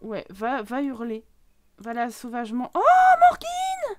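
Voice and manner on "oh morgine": very high, loud, drawn-out wail; shocked cry. Real, not acted. Player seeing Morgine attacked in the game, shocked cry. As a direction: shriek the name, stretched out.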